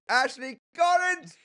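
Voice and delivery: loud, high, drawn-out dramatic name call; romance protagonist. Shown as a demonstration. Parent reading aloud as a romance protagonist, hammed-up cry. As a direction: belt out the name like a swooning heroine. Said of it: "like a Harley Quinn romance protagonist".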